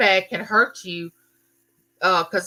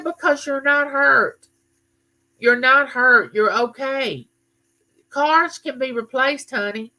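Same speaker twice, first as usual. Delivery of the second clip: reassuring mom; 6 semitones higher than usual. Retold or imitated. Mother reassuring upset daughter.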